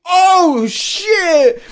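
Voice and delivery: high, loud, stretched exclamation; retold shocked cry. Man recalling finding vomit everywhere, shocked cry. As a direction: cry out as if just finding the mess.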